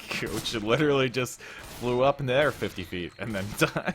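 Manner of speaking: slow, fighting back a laugh; trying not to laugh. Real, not acted. Caster telling how Coach flew up and died, suppressing laughter. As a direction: hold the laugh in until it slips out. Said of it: "I'm trying not to laugh here".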